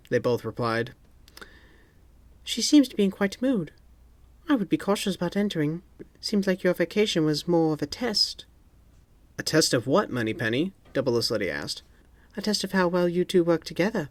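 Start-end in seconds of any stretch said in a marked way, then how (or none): 2.4-14.1 secretary voice. A narrator's reading. Moneypenny speaks.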